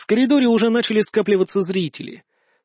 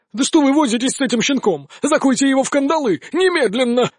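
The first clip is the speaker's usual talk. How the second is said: angry; 6 semitones higher than usual. Acted. Patriarch demands arrest.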